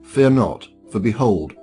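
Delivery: ordinary talk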